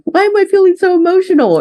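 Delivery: a hint of weepy frustration at herself; mock teary self-question. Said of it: "upset at myself for feeling emotional".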